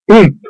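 short winded grunt as he lands; landing thump grunt. A narrator's reading. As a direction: knock the air out with an oomp. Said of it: "he grunted".

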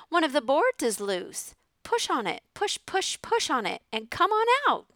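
high goose voice, stuttering the pushes; honking goose. A narrator's reading. The goose urges the pig to push the loose board, honking high. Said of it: "said the goose".

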